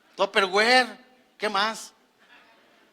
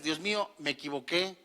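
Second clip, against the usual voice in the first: mock contrite prayer; 6 semitones lower, 6 dB quieter than usual. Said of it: "Pero ahora vienes".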